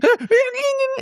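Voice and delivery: very high quavering squeal; frightened creature whimper. Acted. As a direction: whine like a tiny scared critter. Creature shaking with fear.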